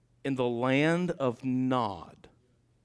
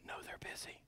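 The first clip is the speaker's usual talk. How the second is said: put-on child's whisper; 19 dB quieter than usual.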